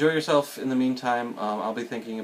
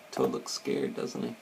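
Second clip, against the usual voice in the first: plain reading voice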